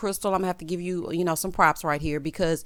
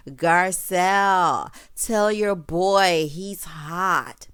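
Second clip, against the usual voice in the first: drawling mock Erica; 5 dB louder, much slower than usual. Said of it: "she's like".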